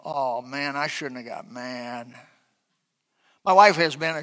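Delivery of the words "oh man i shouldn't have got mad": soft, slow ashamed mumble; sheepish regret. Pretend. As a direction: realize you were wrong. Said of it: "I feel".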